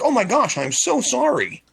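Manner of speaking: high, slow, syrupy fake apology. Waiter to kid who ran into him, fake sweet apology. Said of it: "I'll be like".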